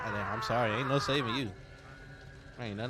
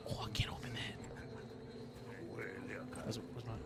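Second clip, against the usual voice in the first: hushed whisper while sneaking; 6 semitones lower, 12 dB quieter, faster than usual. Real, not acted. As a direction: whisper it so nobody hears.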